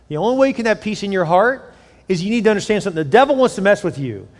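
normal talking voice

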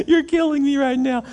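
high, loud mock wail with a laugh; mock agony. Would-be planter inwardly dying at the pastor's news, in mock agony. His dismayed reaction to pastor's plans.

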